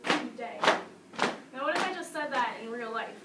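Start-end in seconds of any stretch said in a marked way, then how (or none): 0.0-0.6 odd rhythm. Shown as a demonstration. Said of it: "sound kind of funny".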